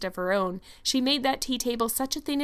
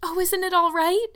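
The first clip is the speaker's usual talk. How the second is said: high, louder anxious plea; anguished cry; 9 semitones higher, 5 dB louder than usual. A narrator's reading. Anne asks Marilla about the cake, in anguish. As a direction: plead anxiously, pitch rising. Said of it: "cried Anne with a look of anguish".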